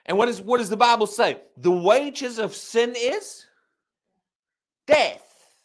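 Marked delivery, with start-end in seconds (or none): 1.6-5.5 preacher landing the punchline; dramatic emphasis. Real, not acted. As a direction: draw it out and hit death hard. Quoting scripture for emphasis.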